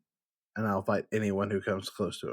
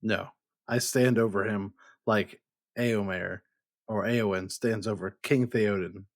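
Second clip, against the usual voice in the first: ordinary talk, nothing marked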